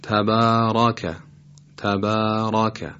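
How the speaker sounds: slow تبارک, both r and following vowel thick; heavy ra and fatha. Shown as a demonstration. Tajweed teacher showing the correct way, heavy ra and fatha in تبارک. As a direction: draw out تبارک with a full, dark ra.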